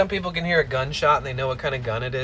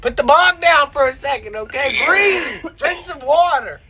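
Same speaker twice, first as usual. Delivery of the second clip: higher, louder exasperated commands; mock yelling; 8 semitones higher, 5 dB louder than usual. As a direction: bark orders at a coughing roommate. Yelling at the coughing clip.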